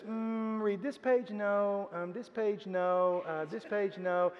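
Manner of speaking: put-on soft, dropped child's voice, hesitating; granddaughter voice. Granddaughter picking a page for Pop, soft hesitant child voice. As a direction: murmur like a little girl flipping through pages.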